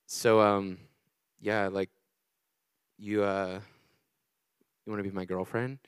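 halting, very low and soft; awkward deep-voiced ask with long hesitations. Nervous boy asking his crush out, voice dropped low. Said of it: "so I lower my voice".

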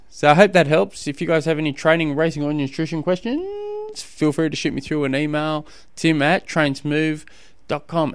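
upbeat announcer sign-off; podcast outro voice. Acted. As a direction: wrap up like a radio host.

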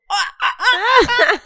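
rising high squeals of excitement; mock thrilled squeal. Recalling a concert's opening, squealing with anticipation. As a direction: squeal like the concert just started.

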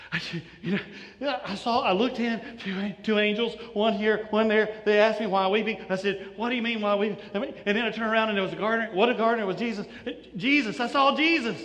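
acted breathless, stumbling report; out of breath, excited. Mary bursting in on the disciples, breathless and excited. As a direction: pant first, then tumble out the news excitedly. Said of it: "she's out of breath".

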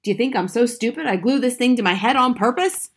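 loud, high, indignant question; angry shout. A narrator's reading. Husband defending himself to wife, shouting angrily. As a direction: shout in outraged disbelief. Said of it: "Mister Wormwood shouted".

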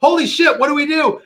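high, panicked yelp; alarmed and frantic. Retold or imitated. Retold panic in emergency.

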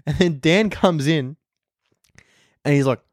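normal talking voice

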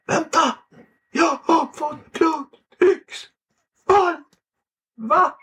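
high, slow, tearful mock faint; man at the car dealer, faint from shock, whimpers high; near fainting. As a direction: gasp it out high and drawn-out, about to faint. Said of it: "Han höll på att svimma".

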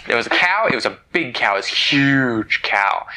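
emphatic reveal. A narrator's reading. Parent telling children about the huge cow, emphatic reveal. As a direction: play up the size, stretching "huge".

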